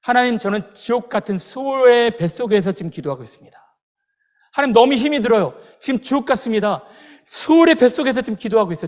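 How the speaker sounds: loud, high cry for help; Jonah's desperate prayer. Retold or imitated. Prophet praying from inside the fish, desperate and loud. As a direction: call out to God in distress, acting it out. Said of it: "기도하잖아요".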